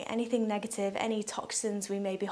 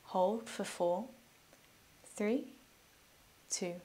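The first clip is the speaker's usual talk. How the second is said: soft, unhurried meditation voice with long pauses; guided meditation; 2 semitones lower, 6 dB quieter than usual. Real, not acted. Yoga teacher guiding listeners through breathing, calm guided meditation.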